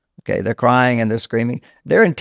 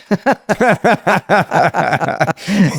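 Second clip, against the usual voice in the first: big open laugh, pitched up; hearty real laugh; 7 semitones higher, 3 dB louder than usual. Real, not acted. Guest laughing at the host's marriage-as-boot-camp joke, hearty laugh.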